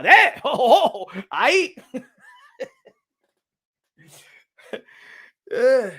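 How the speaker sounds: cracking up, words lost in laughter; real laughter breaking the sentence; laughing. Real, not acted.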